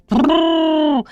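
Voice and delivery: high, sustained rolled halting call; long trilled "trrr". A narrator's reading. Driver's call carrying into the house, long trill. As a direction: imitate a driver stopping horses.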